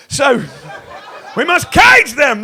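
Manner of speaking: usual speaking voice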